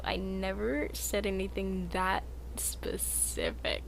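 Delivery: slow hushed whisper. Real, not acted. Streamer whispering a denial to chat. Defensive aside.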